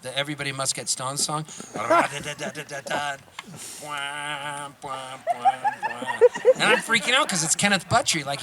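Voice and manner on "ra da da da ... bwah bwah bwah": vocal drum fill, da-da-da then bwah hits; beatbox the drum intro; mouth drum beat. Shown as a demonstration. Storyteller demonstrates famous drum intro with mouth sounds. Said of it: "that does that drum beat".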